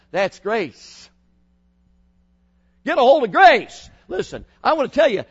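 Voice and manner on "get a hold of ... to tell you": high, loud preaching cry; preaching shout. Acted. Preacher urging teenagers, shouting high. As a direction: belt it out to the kids.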